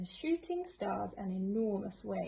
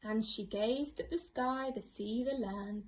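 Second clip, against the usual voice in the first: ordinary delivery, nothing marked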